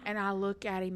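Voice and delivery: ordinary talk, nothing marked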